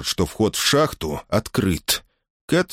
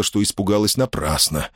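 ordinary delivery

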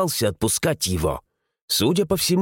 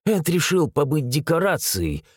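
plain reading voice

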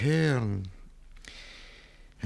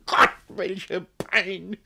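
very slow, higher drawn-out lament; anguished sigh; 9 semitones higher, much slower than usual. Acted. Jealous guardian in torment, very slow anguished cry. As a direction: ache through each word.

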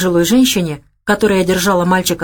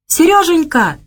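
high, anguished call of a grandson's name; desperate cry; 10 semitones higher, 3 dB louder, slower than usual. A narrator's reading. Grandmother screams for her stolen grandson, desperate cry. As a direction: cry out the boy's name in despair. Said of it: "в отчаянии вскрикнула бабушка".

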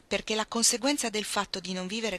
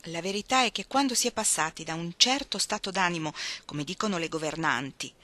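usual speaking voice

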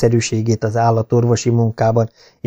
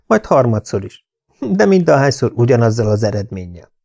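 ordinary delivery, nothing marked

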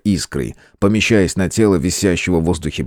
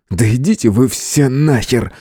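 grumbling; 4 semitones higher than usual. A narrator's reading. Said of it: "проворчал он".

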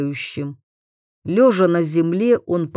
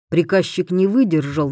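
usual speaking voice

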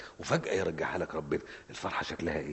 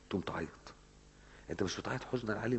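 usual speaking voice

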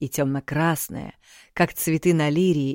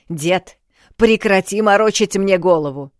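loud, higher angry shout; 4 semitones higher, 7 dB louder than usual. A narrator's reading. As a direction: yell at him to stop. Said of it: "Не ори".

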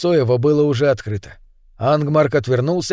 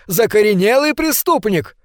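insistent shout; 7 semitones higher than usual. A narrator's reading. Angmark keeps insisting.

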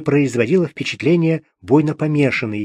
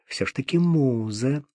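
plain reading voice

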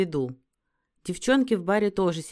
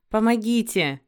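raised, higher and louder narrated shout; restrained scream for rescue; cry for help; 5 semitones higher, 6 dB louder than usual. A narrator's reading. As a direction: call out for help, a contained storyteller's yell. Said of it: "закричала изо всех сил".

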